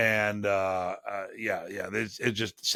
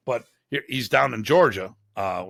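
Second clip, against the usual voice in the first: ordinary talk, nothing marked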